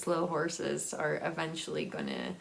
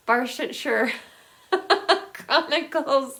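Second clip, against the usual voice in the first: laughing relief; 6 semitones higher, 7 dB louder than usual. Real, not acted. Finally getting the title right.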